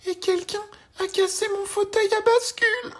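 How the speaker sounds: very high, loud tearful little bear; crying Baby Bear. A narrator's reading. Baby Bear wails over his broken rocking chair, very high and tearful. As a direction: squeal it like a crying cub. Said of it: "dit Bébé Ours en pleurant".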